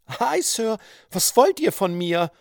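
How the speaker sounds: high-pitched affronted woman; startled woman. A narrator's reading. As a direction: challenge the intruder in a high voice. Startled woman challenges the intruder. (empörte hohe Frauenstimme)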